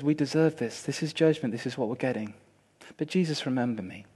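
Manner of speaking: soft, low confession; dying thief to Jesus, soft and low; humble plea. Acted. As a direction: quietly ask to be remembered.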